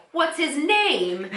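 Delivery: high, slow mock question; quoting the girls. Host voicing Rachel and Phoebe asking the neighbour, slow and high. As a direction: stretch it out, curious and high. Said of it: "And they're like".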